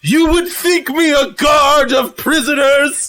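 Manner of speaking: high, affronted theatrical shout; indignant outcry. Acted. Lucy, taken for a prison guard, high indignant shout. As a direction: cry out in mock-wounded outrage. Insulted robot cook cries out.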